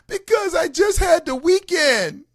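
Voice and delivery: high, loud, drawn-out mock wail; imitating a child's bawled protest; crying six-year-old. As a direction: wail the excuse like a little boy. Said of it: "He's crying".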